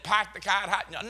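put-on "Park the car at Harvard" in a Boston accent, dropped r's, "pahk the cah"; wife showing off her Boston accent to the barista. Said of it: "So she said".

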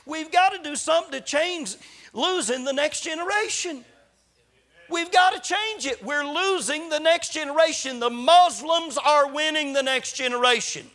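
high, loud preaching climax; rally the congregation with urgency; preaching at full force. Real, not acted.

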